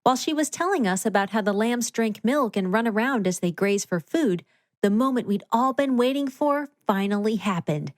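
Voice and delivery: plain reading voice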